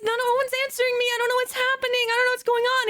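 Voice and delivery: high, rapid helpless babble; lost and frantic, hammed-up; mock panic. Acted panic to friend when lost.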